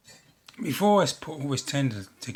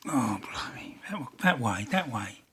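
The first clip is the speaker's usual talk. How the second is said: whisper; 4 dB quieter than usual. Real, not acted. Fiddly work, muttered to himself.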